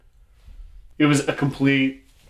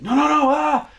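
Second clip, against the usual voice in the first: high, loud mock panic; panicked high-pitched cries; freaking out; 5 dB louder than usual. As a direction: imitate a man freaking out, ending in a yelp. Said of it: "he started freaking out".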